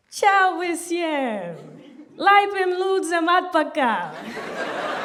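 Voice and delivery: slow, loud, drawn-out Latvian welcome; loud slow Latvian greeting. Retold or imitated. Comedian greeting wedding guests in loud slow Latvian. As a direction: belt the Latvian greeting slowly to the wedding crowd. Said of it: "and I said".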